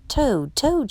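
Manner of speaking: a mild storybook shout. A narrator's reading. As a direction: call out for a friend, mildly. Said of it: "shouted Frog".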